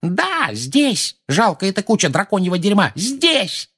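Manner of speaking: very high screeched insults; high-pitched old woman's fury; furious yelling. A narrator's reading. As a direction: scream it at the culprit. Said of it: "заорала миссис Фиг".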